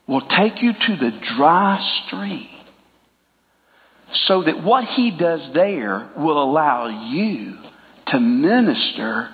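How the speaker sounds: measured, slow, low delivery; preaching a point to the church, slow and low; slow and deliberate. Real, not acted. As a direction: lay each phrase down slowly. Slow emphatic sermon point.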